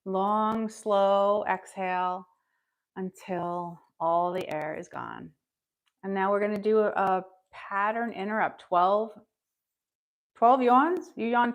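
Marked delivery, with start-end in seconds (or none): 0.0-5.3 slow, calm breathing instructions; guided breathing. Acted. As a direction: talk them through a long exhale.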